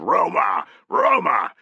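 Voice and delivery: loud chanted Roma; battle chant rising; shouts. Acted. Legionaries shouting Roma, loud. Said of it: "continuing shouts of".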